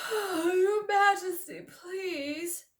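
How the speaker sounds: high, slow drowsy bear voice; thick sleepy bear. Acted. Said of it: "a thick, sleepy voice".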